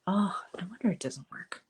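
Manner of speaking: real whisper to herself; whispered aside. Real, not acted. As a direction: whisper it under your breath as you spot the problem. Muttering to herself at a bug.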